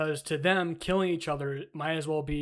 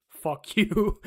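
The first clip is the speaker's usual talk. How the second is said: laughing; 6 semitones higher, 7 dB louder than usual. Real, not acted. Laughing after insulting rant.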